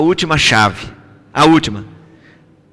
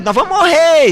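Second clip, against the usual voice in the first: much higher, thin voice of fear; frightened cry; 12 semitones higher than usual. Retold or imitated. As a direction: wail it as if about to drown. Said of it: "começou a ficar com medo".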